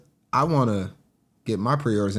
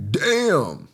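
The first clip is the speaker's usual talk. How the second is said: impressed; 6 semitones higher, 3 dB louder than usual. Real, not acted. Reacting to high price.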